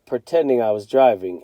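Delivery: ordinary delivery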